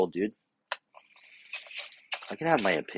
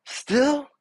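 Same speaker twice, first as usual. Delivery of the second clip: very high disbelieving "Still?"; incredulous. Retold or imitated. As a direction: shoot up in disbelief. Incredulous reply.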